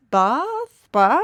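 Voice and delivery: "Bath" as a questioning "bahth"; trying out Bath. Shown as a demonstration. Puzzling over the town's name, "Bath" with uncertain broad vowel. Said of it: "you say it with an R".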